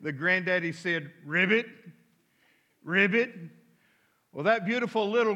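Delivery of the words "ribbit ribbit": ribbit ribbit like a cartoon frog, lightly voiced. Granddaddy croaking for his granddaughter, mild frog voice. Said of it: "in his best frog voice".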